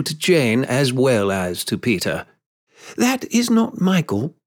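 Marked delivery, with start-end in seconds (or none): 3.0-4.4 high, anxious correction; mildly anxious, high-pitched denial; hasty denial. A narrator's reading. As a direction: get it out before being judged. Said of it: "she said quickly".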